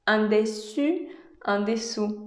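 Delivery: "dessus" with rounded u, "dessous" with ou; slow careful "En dessus, en dessous"; French contrast. Shown as a demonstration. Learner trying to tell above from below in French. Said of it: "decir arriba o abajo".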